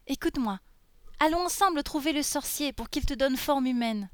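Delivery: put-on high little-girl character voice; eager little girl. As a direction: voice a small girl coaxing her friend.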